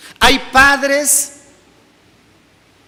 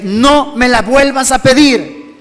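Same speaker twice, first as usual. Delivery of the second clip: raised voice, slow scolding; retold angry shout; 5 dB louder, slower than usual. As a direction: re-enact a father yelling at his daughter, loud and drawn out. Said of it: "y le grité". (grito de regaño imitado)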